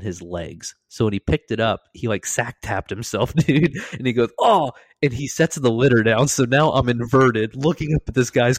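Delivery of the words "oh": high, loud "oh" of pain; mock pained cry. Medic who just sack tapped himself, mock pained yelp. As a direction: imitate a man hit in the groin. Retold groan after groin hit.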